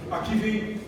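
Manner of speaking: ordinary talk, nothing marked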